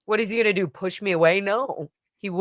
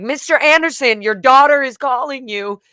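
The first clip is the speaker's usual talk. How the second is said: calling out; 5 semitones higher, 5 dB louder than usual. Retold or imitated. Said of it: "He was like".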